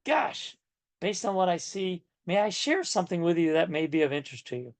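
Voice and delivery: tactful, unforceful suggestion; gentle sharing. Shown as a demonstration. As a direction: offer it gently, as an invitation. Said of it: "a little bit more impactful".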